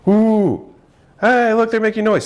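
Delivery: high-pitched gushing parent voice; mock delighted cooing. Parents celebrating baby's first noise, mock delighted cooing.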